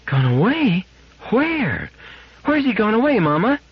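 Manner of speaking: put-on high, bewildered child voice; a small girl asking where her friend went; child's voice pitched high; little girl. Narrator voices little Gloria.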